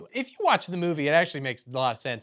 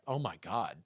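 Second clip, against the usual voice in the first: usual speaking voice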